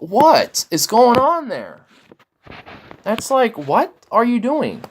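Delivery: high, loud cries of disbelief; incredulous outburst. Real, not acted. Streamer finds the man films talks in his car, incredulous. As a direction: wail it, stretched out and high-pitched. Mock outrage at video made in car.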